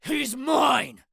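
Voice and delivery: high, loud possessive shout. A narrator's reading. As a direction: claim your prey with a roar. Mad Dog claims the fight.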